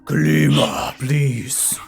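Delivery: big booming call from above. Acted. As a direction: boom it out like a giant. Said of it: "You hear a booming voice".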